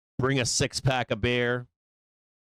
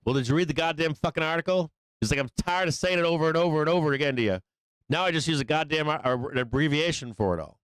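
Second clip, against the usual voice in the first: mock scolding without truly yelling, raised pitch; exasperated, not really yelling; 6 semitones higher than usual. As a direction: imitate a fed-up repeat, pitched higher. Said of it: "he's not really yelling".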